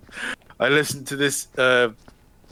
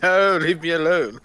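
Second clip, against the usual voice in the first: higher, whiny protest, then dropping; mock fleeing student; 7 semitones higher, 4 dB louder than usual. Student being chased, whining protest. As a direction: whine like a cornered kid. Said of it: "It's like".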